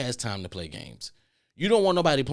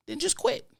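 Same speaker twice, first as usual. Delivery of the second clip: high squeak. Real, not acted.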